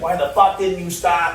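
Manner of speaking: imitated cop yelling. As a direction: bark it like an angry cop. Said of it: "yelling at him in Spanish".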